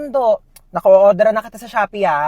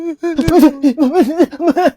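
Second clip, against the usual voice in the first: high, wordless wailing cry; hammed-up bawling; blubbering sobs; 5 semitones higher, 4 dB louder than usual. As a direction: sob so hard no words come out. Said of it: "Ayusin mo, hindi kita maintindihan".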